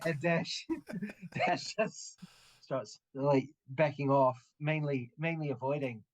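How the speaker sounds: usual speaking voice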